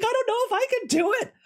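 very high, fast squeal; panicky. Retold or imitated. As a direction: gabble it in a mock panic. Panicked character on the train.

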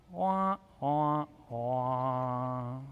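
slow, falling "wah, wah, wah"; sad trombone. Acted. Preacher mocking the letdown in Jesus's reply, sad-trombone wahs. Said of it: "what Jesus says next is probably like".

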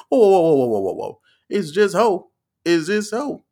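high, loud mock pleading; backpedalling preacher. Preacher winning the church back, hammed-up protest. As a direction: call out over an upset crowd. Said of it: "he was trying to get him back on his side".

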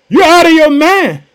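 high, loud incredulous exclamation; loud disbelief. Acted. Church dismissing the servant girl's news, incredulous cry. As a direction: throw it back in total disbelief.

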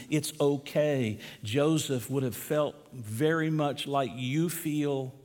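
normal talking voice

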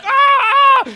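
very high, loud shrieks; imitated child's terrified screaming; blood-curdling scream. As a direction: scream in short, piercing bursts. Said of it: "blood-curdling screams".

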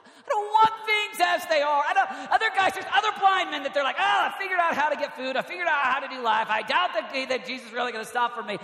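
high, loud outburst settling into quick talk; impassioned preaching. Acted. Preacher voicing discontented and resigned blind men, fired up. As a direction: burst out, then rattle through the excuses.

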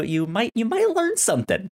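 normal talking voice

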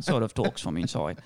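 normal talking voice

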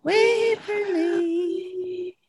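high, very slow, drawn-out ghost call; ghostly voice calling. Retold or imitated. A ghost calls Waverly's name, high and drawn out. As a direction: stretch the name out like a spirit beckoning. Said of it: "this ghostly voice calling her name".

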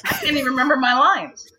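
high, loud comic freak-out; retold panic. Actress to casting director before the final callback, comic panic.

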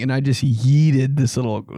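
ordinary talk, nothing marked

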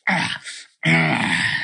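ordinary talk, nothing marked

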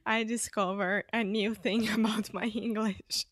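laughing through the words; giggling fits between words; hiccup giggles. Real, not acted. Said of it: "I can't stop laughing".

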